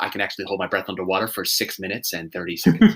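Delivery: low interview answer; Tom Cruise impression. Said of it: "He's like".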